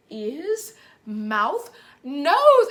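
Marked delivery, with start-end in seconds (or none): 2.0-2.7 excited. Real, not acted. Cheering the kids' last word.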